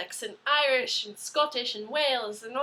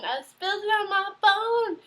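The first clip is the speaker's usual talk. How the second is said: higher, louder cry of disbelief at her own clumsiness; mock outraged disbelief; 8 semitones higher, 6 dB louder than usual. Real, not acted. Telling viewers she ruined her phone, higher, louder disbelief. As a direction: exclaim it like you can't believe you did it.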